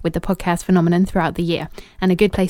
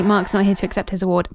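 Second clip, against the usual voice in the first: ordinary talk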